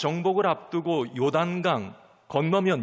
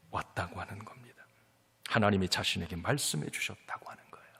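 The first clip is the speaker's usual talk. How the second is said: whisper; 8 semitones lower, 7 dB quieter than usual. Acted. Preacher drops to hushed voice.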